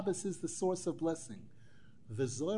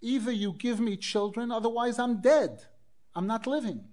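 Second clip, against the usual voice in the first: acted-out ultimatum, higher and louder; desperate demand; 5 semitones higher, 6 dB louder than usual. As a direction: demand it as a mock threat, raised voice. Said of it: "put a gun to his head and said".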